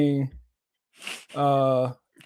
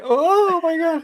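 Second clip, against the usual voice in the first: high, drawn-out mock gasp of disbelief; exaggerated high-pitched astonishment; mock shock; 13 semitones higher, slower than usual. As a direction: stretch it out high, playing stunned. Retells own shocked reaction.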